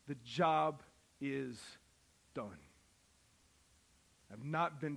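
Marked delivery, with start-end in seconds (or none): 0.1-2.6 drawn-out, deliberate statement; slow it right down for weight; slow emphasis. Real, not acted. Preacher driving home that the job is done, slow.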